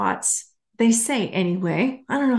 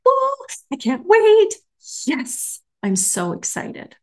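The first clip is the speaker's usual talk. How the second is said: thrilled exclamation dropping to a happy aside; excited; 11 semitones higher than usual. Retold or imitated. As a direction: gush with anticipation, then settle. Recalls thrill at seeing Oprah.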